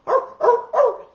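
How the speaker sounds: high, loud yappy barks; dog barking. Retold or imitated. The doggy leads the parents, barking high and loud. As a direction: bark like a small dog.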